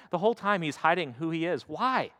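usual speaking voice

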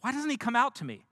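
high, loud offended demand; outraged nobleman's cry; indignant outburst. Acted. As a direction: bellow it like an insulted general. Naaman fuming at prophet's snub.

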